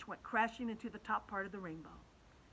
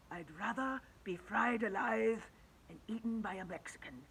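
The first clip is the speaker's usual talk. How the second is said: wailing grasshopper; 3 semitones higher than usual. A narrator's reading. Old grasshopper dreading the cloud men, mild wail. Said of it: "wailed the old green grasshopper".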